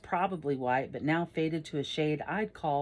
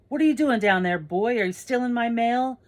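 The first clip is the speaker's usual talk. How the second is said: loud, raised accusing challenge; cranky neighbour demanding answers; angry old man; 7 semitones higher, 8 dB louder than usual. Acted. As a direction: holler at a kid from the porch. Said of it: "Then the guy goes".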